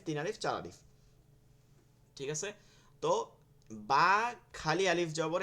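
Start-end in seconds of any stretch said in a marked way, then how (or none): 3.7-5.4 slow, with বা stretched one alif long; slow elongated বা demo; drawn-out বা. Shown as a demonstration. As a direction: hold the vowel of বা a beat longer. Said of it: "এখানে বা একটু টান হইছে".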